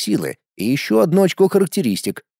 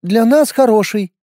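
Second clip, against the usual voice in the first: put-on high little boy's voice; a small boy judging people; child voice; 8 semitones higher, 3 dB louder than usual. A narrator's reading. (детский голос)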